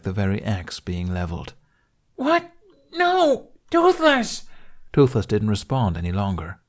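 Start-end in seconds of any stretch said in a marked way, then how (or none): 2.2-4.4 very high, louder anguished shouts; horrified cry. Acted. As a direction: cry out in disbelief. Hiccup's horrified outburst.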